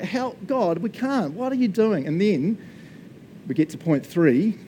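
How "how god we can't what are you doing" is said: higher-pitched pleading complaint; mock protest. People protesting to God about an impossible task, higher-pitched pleading. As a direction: plead upward in put-on exasperation.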